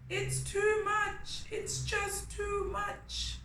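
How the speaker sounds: very high, slow whiny little voice; despairing ant. Acted. Ant wailing that the work is too much. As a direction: squeak it out in despair.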